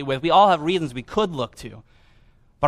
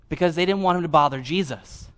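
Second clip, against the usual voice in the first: ordinary talk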